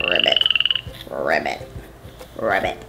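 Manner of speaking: ribbit croaked way down low, like a frog; deep frog croak. Pretend. Frog sound for children.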